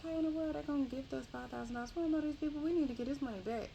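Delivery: wife frantic to return the money; freaking out. Retold or imitated. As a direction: rattle it off in a high-pitched panic. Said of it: "Rhea is freaking out".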